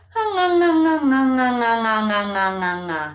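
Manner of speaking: "nga" sung through the soft palate; nga sound exercise. Shown as a demonstration. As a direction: ring "nga" in the nose, repeated. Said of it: "Find that space in the soft palate".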